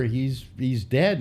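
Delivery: usual speaking voice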